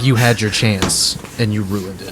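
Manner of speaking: slow, low condemnation; cold contempt. Acted. Bard passing judgment on a beaten opponent, cold. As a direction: let it sink, quiet and measured.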